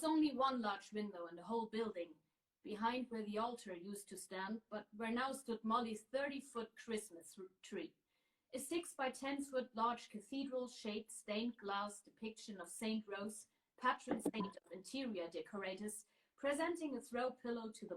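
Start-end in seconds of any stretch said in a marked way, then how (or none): none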